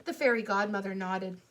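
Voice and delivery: plain reading voice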